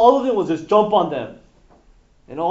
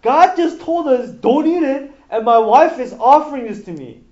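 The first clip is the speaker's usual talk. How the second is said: higher, louder alarm in Adam's head; a startled man catching himself, raised and urgent; Adam's alarmed thought; 7 semitones higher, 8 dB louder than usual. Retold or imitated. Preacher voicing Adam's alarm as his wife offers the fruit. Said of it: "Adam should have thought".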